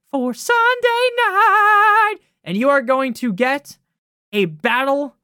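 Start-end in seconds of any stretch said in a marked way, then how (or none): 0.1-2.2 higher, louder, slowed showman drawl; stretched-out mock announcer build-up; mock hype announcer. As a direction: oversell the big game sarcastically. Mock hype for Sunday night.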